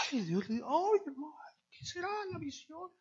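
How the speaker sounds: high hushed breathless excitement; mock the eager church member; high whispery awe; eager congregant.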